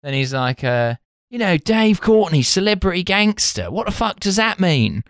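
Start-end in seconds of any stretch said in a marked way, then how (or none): 1.3-5.0 ape a far-out nineties [name] singer. Retold or imitated. Host imitating the sculptor in a [name]-style voice. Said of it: "like, far out, like, [name] voice from the nineties".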